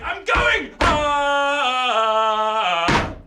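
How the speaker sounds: high, loud cry; shout as he leaps. Acted. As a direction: jump in yelling. Leaping into the portal.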